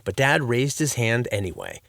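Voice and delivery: plain reading voice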